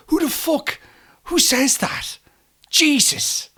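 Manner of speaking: high, strangled self-reproach; real cringing exasperation. Real, not acted. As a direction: squeal it out, still mortified years later. Cringing at own remembered gaffe.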